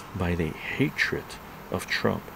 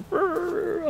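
high-pitched imitated "rrr" of fury; mock angry growl. Imitating the angry mother, mock growl. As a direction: growl high, mocking her getting madder. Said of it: "she got really mad".

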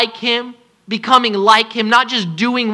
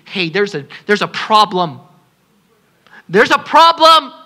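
urgent warning building up. Acted. Loving warning voiced.

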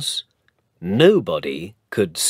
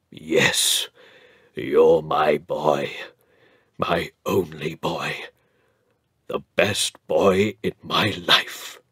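shaky and tender; 4 semitones lower than usual. Acted. Earl reassuring his grandson, tender and a little shaky. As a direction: let gruffness melt into love. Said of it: "his voice was a little shaky too".

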